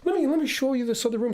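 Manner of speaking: put-on high, sweet old-lady greeting; sweet elderly lady. Narrator imitates the kind Steinway store lady greeting him. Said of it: "She said".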